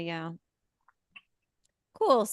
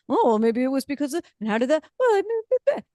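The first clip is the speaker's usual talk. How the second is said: higher, fumbling put-on voice of someone making excuses; a lightly voiced flustered excuse-maker; mock rambling excuse; 6 semitones higher than usual. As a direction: hem and haw like a person dodging blame.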